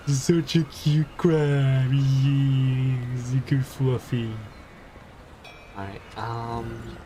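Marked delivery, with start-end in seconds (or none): 0.1-4.3 put-on baby talk to pet. Cooing at pet crab.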